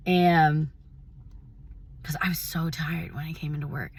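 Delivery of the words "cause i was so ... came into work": a weary, fast whisper; whispered tiredness; tired whisper. Retold or imitated. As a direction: breathe it out like you're worn down. Tired recollection.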